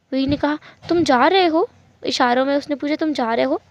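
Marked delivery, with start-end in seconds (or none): 0.9-1.7 higher, louder, rising question; startled question. Acted. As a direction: ask it in sudden alarm, voice jumping up. Wing asks whether he is leaving. (चौंककर ऊँची आवाज़ में सवाल)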